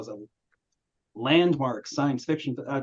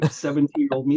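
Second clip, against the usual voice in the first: ordinary talk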